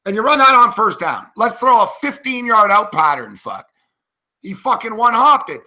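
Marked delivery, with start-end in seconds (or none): 3.3-3.7 low, soft curse under the breath; muttered curse. Real, not acted. Fan muttering at the TV, quiet curse. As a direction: swear quietly to yourself in disgust.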